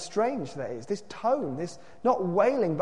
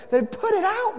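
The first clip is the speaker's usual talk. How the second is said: loud, very high outcry; high cry of protest; 6 dB louder than usual. A narrator's reading. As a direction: cry out in alarm, pitched right up. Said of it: "they cried".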